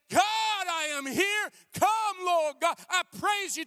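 high slow shouted plea; crying out to God. Acted. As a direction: wail the prayer upward, pleading. Voicing a crying prayer.

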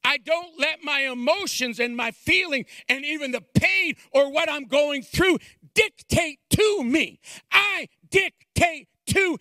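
building from plain talk to high, loud, shouted preaching; full-force preaching. Acted. Pastor urging the congregation toward salvation, preaching at full force. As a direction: climb into a fiery altar-call shout.

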